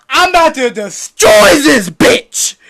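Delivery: slow, loud, high battle cry; Luffy shouting. Retold or imitated. Luffy vowing to destroy the island, slow loud yell. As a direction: scream the vow to wreck the place. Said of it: "And he's like".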